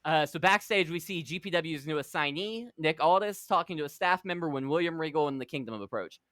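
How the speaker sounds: normal talking voice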